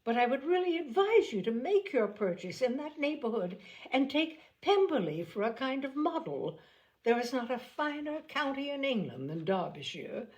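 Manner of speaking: fawning Miss Bingley. A narrator's reading. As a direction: fawn over Darcy's estate. Miss Bingley flattering Darcy.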